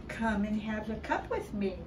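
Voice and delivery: usual speaking voice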